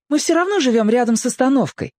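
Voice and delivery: put-on high, quick girl's voice; insistent girl. A narrator's reading. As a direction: explain hurriedly as a girl. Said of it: "вдруг закричала девушка". (быстро, высоким девичьим голосом)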